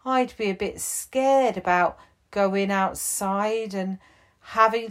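comforting a nervous friend; gentle chief. Acted.

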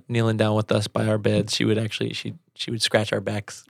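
ordinary delivery

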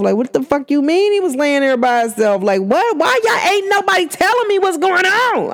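acted-out frustrated outburst. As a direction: build to a high, exasperated question. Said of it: "I would be frustrated".

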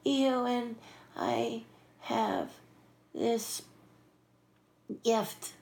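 high, halting imitated star voice. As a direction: deliver it haltingly, a pause between phrases. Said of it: "look into my face and go".

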